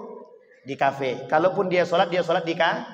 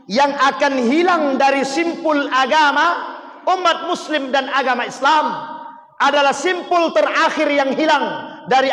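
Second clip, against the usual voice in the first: impassioned preaching; 7 semitones higher, 5 dB louder than usual. Acted. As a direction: cry the Prophet's words out to them. Preacher quotes hadith with force.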